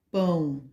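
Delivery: "pão" as one unbroken syllable; one single syllable. Shown as a demonstration. Teacher showing children a one-syllable word, "pão" in one beat. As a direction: say "pão" in one mouth opening. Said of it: "Pão se fala uma vez só".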